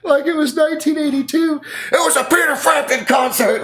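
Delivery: high, loud, hammed-up whiny voice; mock talk show complaint. Wife complaining to Doctor Phil, high loud whiny mock voice. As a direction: whine to the TV host in a high squeal. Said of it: "had to go on Doctor Phil".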